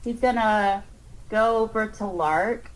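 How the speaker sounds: normal talking voice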